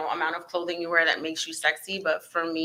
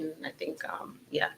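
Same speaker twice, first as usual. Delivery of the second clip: normal talking voice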